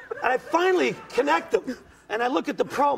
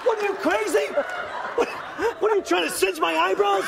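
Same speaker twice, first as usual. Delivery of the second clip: outraged; 8 semitones higher than usual. Retold or imitated. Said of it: "And I'm like".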